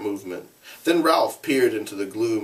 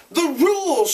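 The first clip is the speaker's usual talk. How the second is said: much higher, louder cry; shout; 4 dB louder than usual. Acted. Ralph shouting at Jack about the rules. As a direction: shout it out against the noise. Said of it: "shouted Ralph".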